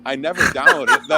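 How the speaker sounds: high, loud laughing words. Real, not acted. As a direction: laugh it out, barely holding together.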